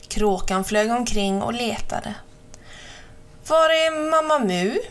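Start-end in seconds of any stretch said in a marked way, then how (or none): 3.5-4.8 higher, drawn questioning call; searching crow. A narrator's reading. Crow looking everywhere for Mamma Mu, searching call. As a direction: call out, looking for a missing friend.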